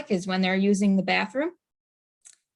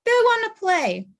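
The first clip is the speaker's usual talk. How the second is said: much higher, breezy and careless; mock oblivious owner; 12 semitones higher than usual. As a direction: toss it off like a naive owner. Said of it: "people who are just like".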